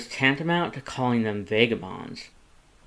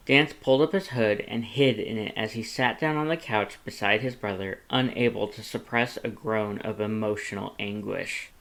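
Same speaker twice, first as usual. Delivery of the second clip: plain reading voice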